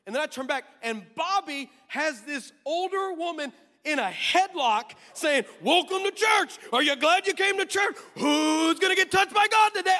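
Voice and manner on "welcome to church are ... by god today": high, loud, booming welcome; over-the-top shouted greeting; overexcited shout. Retold or imitated. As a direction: yell it like a hype man at a rally. Bobby over-enthusiastic greeting in headlock.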